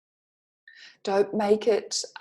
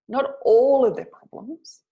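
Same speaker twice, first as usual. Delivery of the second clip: emphatic correction; 4 semitones higher, 6 dB louder than usual. Real, not acted. Coach correcting a misunderstanding.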